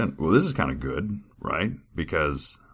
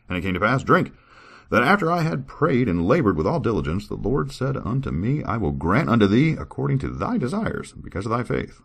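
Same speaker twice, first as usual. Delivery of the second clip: higher, louder formal reading voice; scripture reading; 6 semitones higher, 3 dB louder than usual. Acted. Reader performing a scripture passage for viewers, formal. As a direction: read it like solemn old scripture.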